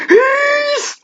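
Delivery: very high squeaky yes; excited cheer. Acted. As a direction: squeal it with glee.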